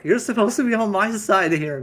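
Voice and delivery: loud, high, fast complaint; indignant protest. Acted. Husband protesting to his disloyal friend, indignant. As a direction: yell in aggrieved disbelief.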